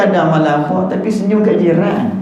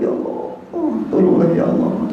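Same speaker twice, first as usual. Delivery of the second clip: pretend weeping; 3 semitones higher, 3 dB quieter than usual. Shown as a demonstration. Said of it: "maka buat-buat teriak".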